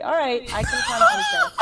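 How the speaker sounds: high, loud laughing burst, quick; laughing outburst. Retold or imitated. Recalling her first take on the film, high laughing shout. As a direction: blurt it out laughing. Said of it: "I was like".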